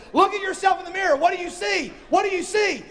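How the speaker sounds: high, fast, shouted taunt. Acted. Satan taunting Brian at the mirror, high shouted jeering. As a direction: jeer at him and hammer the question.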